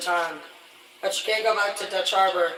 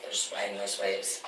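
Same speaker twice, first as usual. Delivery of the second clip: much lower and softer, spooky storm telling; hushed dramatic low voice; 12 semitones lower, 7 dB quieter, slower than usual. Retold or imitated. Recalling the stormy deck to viewers, low hushed voice.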